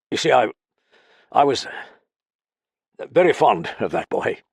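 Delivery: faltering father; hesitant, unstrung. A narrator's reading. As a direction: stumble over the words, holding back. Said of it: "he said hesitantly".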